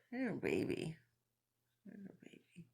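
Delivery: soft coo, fading to barely audible; tender cooing. Real, not acted. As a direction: coo softly over a snoring little one. Cooing at snoring Marty.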